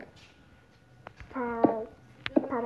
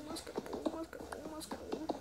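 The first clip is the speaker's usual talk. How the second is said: whispering; 4 semitones higher, 12 dB quieter than usual. Real, not acted. Chanting while mining coal.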